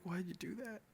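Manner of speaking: retold confrontation. Boyfriend up in her face, retold high-pitched distress. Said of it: "up in her face".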